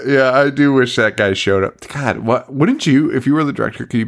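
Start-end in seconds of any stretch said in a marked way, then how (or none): none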